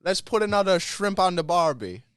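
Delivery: a cartoon Australian tourist voice in a hammed-up Australian accent; mock Australian accent. Trying the accent again for friends, in a hammed-up Australian accent. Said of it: "Try it again".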